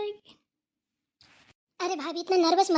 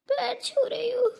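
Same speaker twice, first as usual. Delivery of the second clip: crying as she takes leave; a catch of tears in the voice; tearful; 4 semitones higher, 4 dB quieter than usual. Acted. Tearful farewell to elder.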